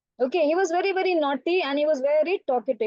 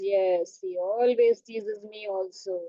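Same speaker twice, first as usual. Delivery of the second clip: low, slow storybook zebra; drawn-out zebra grievance for children; 6 semitones lower, slower than usual. Acted. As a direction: complain slowly in a lowered voice. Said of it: "Zebra says".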